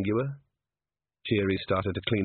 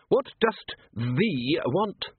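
slow, higher-pitched, superior voice; haughty herald; 6 semitones higher, much slower than usual. A narrator's reading. A voice at the College of Arms grille questioning a visitor, haughty. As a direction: draw it out with aristocratic disdain. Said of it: "owner of the voice made it very clear".

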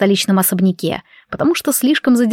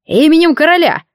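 shouting; 7 semitones higher, 4 dB louder than usual. Acted. Soldiers knock again.

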